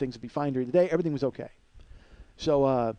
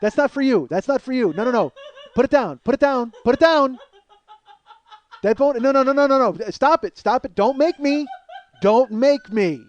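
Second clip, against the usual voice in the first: high, loud dog-owner scolding; hammed-up scolding of a dog that has a bone; mock owner scolding a dog; 8 semitones higher, 7 dB louder than usual. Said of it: "That's what it sounds like".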